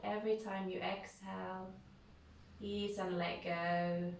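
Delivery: unhurried, calm yoga nidra voice with long pauses; guided meditation. Real, not acted. Leads a guided relaxation.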